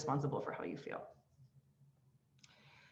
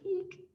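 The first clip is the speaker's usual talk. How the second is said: high squeak; 14 semitones higher, 6 dB quieter than usual. Acted. Mocking codependent line.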